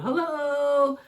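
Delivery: high, drawn-out calling hello; yelled hello. Retold or imitated. Mother retelling how she called into the daycare, yelling. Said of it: "I'm yelling".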